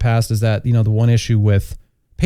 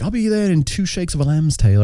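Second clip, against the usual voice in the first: imitating the Blue Raja; 5 semitones higher than usual. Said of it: "I can't do his accent".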